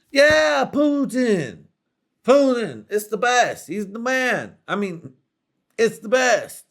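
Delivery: very high, loud proclaiming; loud, high triumphant raving; over-the-top hype. Acted. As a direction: shout it like the greatest thing ever. Fan confirms poutine enthusiastically.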